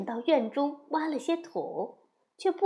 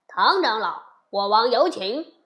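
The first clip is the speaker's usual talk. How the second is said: loud formal summons; palace official; 6 dB louder than usual. A narrator's reading. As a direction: announce the king's invitation firmly.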